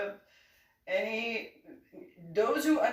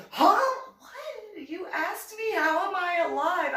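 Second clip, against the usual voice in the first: much higher, confused; baffled; 10 semitones higher, 5 dB louder than usual. Retold or imitated. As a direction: squeak out the confusion. Baffled reply to sergeant.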